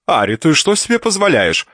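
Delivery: high, loud scolding aunt voice; aunt's shrill scolding. Acted. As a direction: scold her like a bossy old aunt. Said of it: "без приветствия начала тётка".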